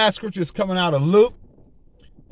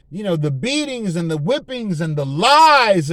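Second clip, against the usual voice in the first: rising, louder preacher cadence, stretching key words; fervent preaching; 3 semitones higher, 6 dB louder than usual. Real, not acted. Pastor to congregation about the cross, fervent preaching. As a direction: preach it with swelling conviction. Preacher building on Christ's joy and shame.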